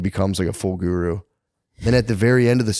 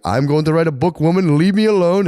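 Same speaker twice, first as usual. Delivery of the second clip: higher, louder hammed-up boast; mock pompous writer; 8 semitones higher, 5 dB louder than usual. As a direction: play the lazy would-be author brushing off his wife. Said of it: "he's like".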